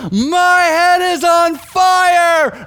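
high, loud, drawn-out cry of agony; full-voiced re-enacted panic scream; imitated screaming. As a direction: scream it slowly, acting out someone in torment. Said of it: "He starts screaming".

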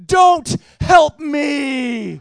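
loud drawn-out shout; retold yell. Dad yelling at his son from the flipped kayak, big slow shout. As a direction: bellow it at your son.

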